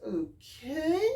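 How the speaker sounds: high okay, rising at the end; retold skeptical okay; dubious reaction. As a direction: stretch it upward, unsure what to make of this. Reacting to his all-Adidas outfit.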